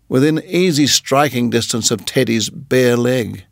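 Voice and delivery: ordinary delivery, nothing marked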